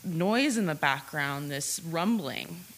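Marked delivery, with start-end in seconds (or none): none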